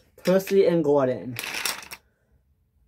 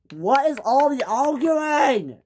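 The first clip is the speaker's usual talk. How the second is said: high, slow scolding call; screamed; 10 semitones higher, slower than usual. Acted. Sir Topham Hatt breaking up the quarrel, shouting. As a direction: demand an answer from the quarrel. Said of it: "screamed Sir Topham Hatt".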